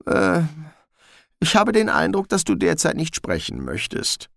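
high, nervous little dog; a jittery mongrel pleading; talking dog voice. Acted. Gespode the dog speaks.

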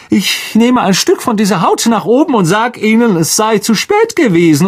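put-on high, smug teacher turning on the boys; gleeful villain dropping the act; Lockhart's voice. A narrator's reading. Lockhart explains his plan.

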